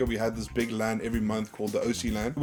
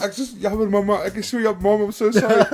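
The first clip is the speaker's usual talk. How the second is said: mock panicked pleading; 9 semitones higher, 7 dB louder than usual. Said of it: "And I'm like".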